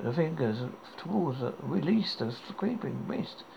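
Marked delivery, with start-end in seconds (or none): none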